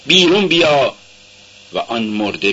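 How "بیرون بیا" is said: calling out high and loud to the tomb. A narrator's reading. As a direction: cry out the name, then the command. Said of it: "به صدای بلند فریاد زد". (لازر، بیرون بیا را بلند و با فریاد بگو)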